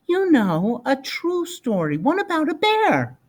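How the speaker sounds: put-on high, eager little boy; small boy coaxing for a bear story; child's voice.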